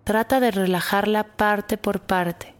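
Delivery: put-on calm guide easing children to relax; guided meditation. Guides a body scan meditation.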